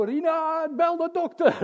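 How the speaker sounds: high, loud call to his wife. Retold or imitated. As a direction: shout it across to Rina. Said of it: "ik riep gelijk naar mevrouw". (luid roepen naar Rina om de dokter)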